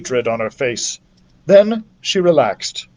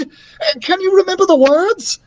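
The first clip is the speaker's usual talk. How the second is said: put-on young girl voice. A narrator's reading. Narrator voices young character.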